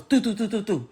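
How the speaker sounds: quick mouth-made gunshot bursts; a string of soft mock gunshot pops; imitated gunshots. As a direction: spit out each shot like a pistol firing. Mimics gunshots heard from porch.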